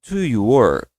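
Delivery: "Do you" run together as one sound; linked do you. Shown as a demonstration. Teacher shows the linked way to say it, joined. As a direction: join "Do you" with no break, "Do you work?". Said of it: "Você vai falar assim, ó".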